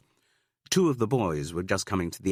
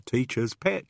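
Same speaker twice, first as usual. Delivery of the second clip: higher crowing jibe; crowing taunt; 6 semitones higher than usual. Acted. Boy taunts the girl, crowing. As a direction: crow it at her like a schoolboy. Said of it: "crowed another".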